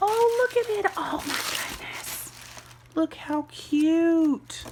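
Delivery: real excited squealing; thrilled squeal. Real, not acted. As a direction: gasp then high squeals, slow cute. Opening gift package.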